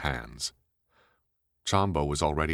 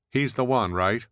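loud; 8 semitones higher, 5 dB louder than usual. Acted. Heidi voiced loud.

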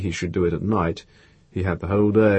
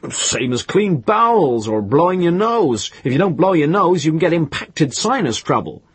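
higher, emphatic voice of a man lecturing; expounding know-it-all; 6 semitones higher, 4 dB louder than usual. A narrator's reading. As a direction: hold forth on a pet theory, pitched up and forceful. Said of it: "liked to expound them to people".

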